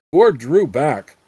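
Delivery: usual speaking voice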